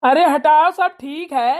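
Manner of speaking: loud call to stop. Real, not acted. Son loudly breaks up the quarrel. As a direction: cut in loudly to end it.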